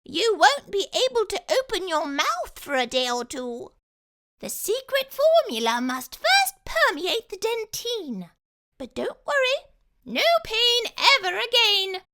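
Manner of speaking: high, loud and cheery; high mouse voice. Acted. Dentist revealing the trick to the fox, high cheery voice. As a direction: explain it brightly in a squeak.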